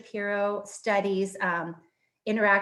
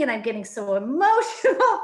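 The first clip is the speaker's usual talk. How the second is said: laughing emotionally; 2 semitones higher, 6 dB louder than usual. Real, not acted. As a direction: laugh through the word, a little overcome. Laughing while admitting emotion.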